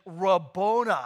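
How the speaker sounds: loud imitated cry, held back from a scream; retold scream. Mary recognizing her risen teacher, retold scream. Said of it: "just screams out".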